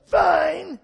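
loud high snapped mock shout; kid's angry fine. Kid answering a friend after an argument, shouting. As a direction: yell it like a sulking kid. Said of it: "their friend's like".

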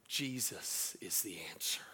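soft, low whisper of reassurance; whispered comfort. Retold or imitated. Pastor comforting a crying nurse, slow whisper. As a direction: breathe it gently, slowly.

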